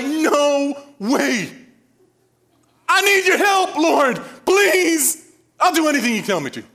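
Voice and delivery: high, loud begging cry; desperate pleading. Retold or imitated. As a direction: plead with the Lord in a panic. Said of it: "we would be screaming".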